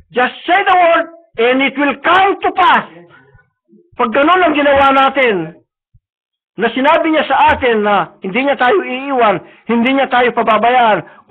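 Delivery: high, loud, impassioned sermon; preaching at full force. Acted. Preacher urging the church to claim God's promise, impassioned. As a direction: rouse the congregation with fervour.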